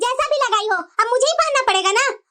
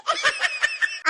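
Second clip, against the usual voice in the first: high, mock villain cackle; a hammed-up wicked laugh; scheming cackle; 7 dB quieter than usual. A mother-in-law gloating over her daughter-in-law, a high mock cackle.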